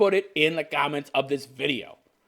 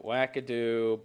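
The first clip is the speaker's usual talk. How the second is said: ordinary talk, nothing marked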